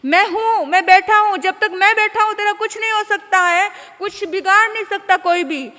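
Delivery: booming hollow reassurance to his son; false assurance. Acted. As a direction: puff up like a politician swearing protection. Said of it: "नेता लोग बड़े झूठे आश्वासन देते हैं".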